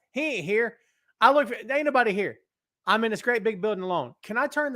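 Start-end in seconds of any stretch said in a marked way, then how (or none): none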